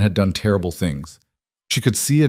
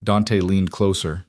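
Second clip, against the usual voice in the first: usual speaking voice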